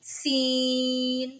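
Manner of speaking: सीन held very long with a hiss; long hissing seen. Shown as a demonstration. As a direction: draw out सीन, whistling. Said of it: "मज़ीद लंबा करेंगे". (सीन लंबा, सीटी के साथ)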